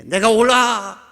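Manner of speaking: loud, high-pitched denial; protesting. Acted. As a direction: protest innocence at the top of the voice. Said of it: "라고 항변할 때는". (큰 소리로 억울한 듯 항변)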